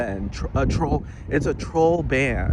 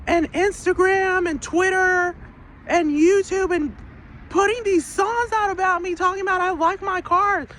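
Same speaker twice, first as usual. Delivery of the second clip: put-on very high, whining kid complaining to mom; child whining about his dad's songs; whiny ten-year-old. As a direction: tattle like a sulky kid. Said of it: "A ten-year-old will flat out say".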